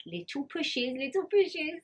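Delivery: coaxing midwife. Retold or imitated. Midwife coaching gentle pushes.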